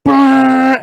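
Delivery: loud imitated horn blast; air horn imitation. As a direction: blare it like a truck horn. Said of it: "it was like".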